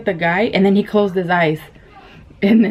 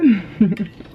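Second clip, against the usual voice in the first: bright burst of laughter; 2 semitones higher than usual. Real, not acted. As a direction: laugh at the memory. Laughing after the boy's answer.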